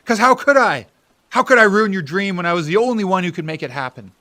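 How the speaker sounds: loud, high cry of frustration; anguished shout. Acted. Bill crying out to his brother why he stayed silent, anguished. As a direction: throw the question back at him.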